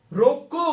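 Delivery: emphatic "रोको" as before a comma; stop on रोको. Shown as a demonstration. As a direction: punch "रोको" with a lifted end, then halt. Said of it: "या ठहराओ है ना".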